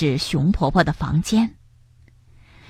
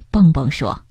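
ordinary delivery, nothing marked

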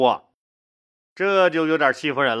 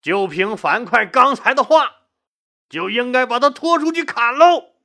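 loud, high-pitched demand; roaring objection; 6 semitones higher, 4 dB louder than usual. A narrator's reading. Court official demanding the general be executed, roaring objection. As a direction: bellow it out, building to the final word. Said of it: "大声吼道".

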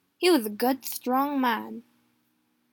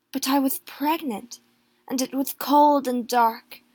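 ordinary delivery

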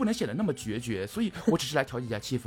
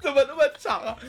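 laughing; 13 semitones higher, 6 dB louder than usual. Real, not acted. Laughing at a silly long phrase.

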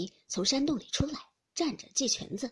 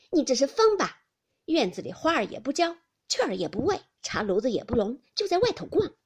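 louder sharp rebuke; scolding; 3 semitones higher, 7 dB louder than usual. Acted. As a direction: tick her off for slacking. Qingwen scolding Hongyu.

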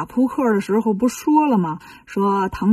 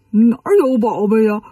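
whiny character voice, lightly exaggerated; complaining Bajie. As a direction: call back up with a grumble.

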